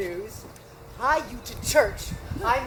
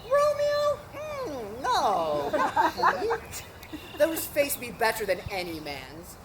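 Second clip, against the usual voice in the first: usual speaking voice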